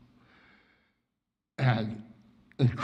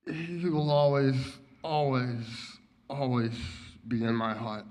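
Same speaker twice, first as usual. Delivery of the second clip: each always softer, voice sinking away; fading grief; 7 dB quieter than usual. Real, not acted. Grieving friend at memorial, softer and softer. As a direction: let the words fade out in grief.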